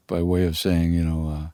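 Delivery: ordinary talk, nothing marked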